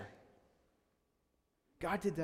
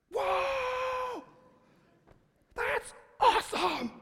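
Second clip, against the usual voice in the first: high, loud whooping shout; mock amazement; 7 dB louder, much slower than usual. Disciples watching the loaves multiply, hammed-up amazed shout. Acting out disciples seeing the miracle.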